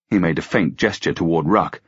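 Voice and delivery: ordinary delivery, nothing marked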